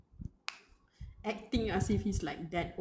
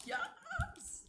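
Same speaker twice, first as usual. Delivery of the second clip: delighted squeal; 7 dB quieter than usual. Real, not acted.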